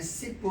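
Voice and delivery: "सिप" with a brief, light i; short i. Shown as a demonstration. Teacher reading a short-i word for students, demonstrated short i. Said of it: "छोटी ई में".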